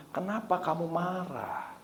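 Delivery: quiet question from an inner voice; gentle, unhurried imitation of God. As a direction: ask it softly, like a voice in the heart. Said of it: "di hati saya ada begini".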